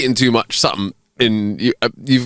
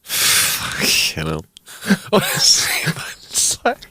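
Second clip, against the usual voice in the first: breathless whispered laugh; 7 semitones higher, 3 dB quieter, much slower than usual. Real, not acted. Cohost reacts to the transcript, laughing and whispering. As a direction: laugh it out under your breath.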